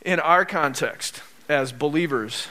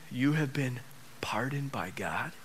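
soft, half-whispered assurance; slow down and drop almost to a whisper; hushed reverence; 3 semitones lower, 10 dB quieter than usual. Real, not acted. Preacher assuring congregation they are pardoned, hushed reverence.

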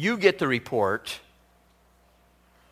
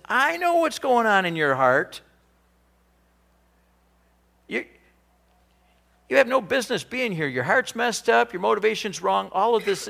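higher, judgmental scolding, fast in places; 7 semitones higher than usual. Retold or imitated. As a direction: accuse him of a bad heart. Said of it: "then he gets judgmental and he says".

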